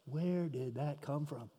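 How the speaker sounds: hushed, slow bewilderment; very quiet, drawn-out inner voice; thinking to oneself. Acted. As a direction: wonder it softly and slowly, as a private thought. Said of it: "they think to themselves".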